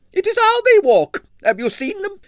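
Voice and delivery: high, loud scornful wail; shrill ballerina. A narrator's reading. Arienskaya continues shrill.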